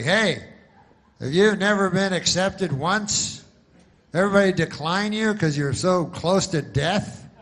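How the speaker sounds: loud, high, hammed-up insurance ad voice; mock TV-ad huckster; pushy commercial pitch. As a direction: sell it like a cheesy TV spokesman.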